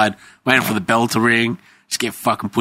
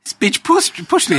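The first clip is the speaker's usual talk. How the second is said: mock indignant whine; slower than usual. Retold indignant reply.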